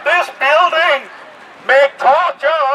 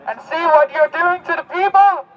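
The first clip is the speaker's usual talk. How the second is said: usual speaking voice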